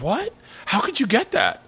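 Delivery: put-on high-pitched incredulous girlfriend; indignant, high mock disbelief; mock shock. Imitates girlfriend's shocked reaction.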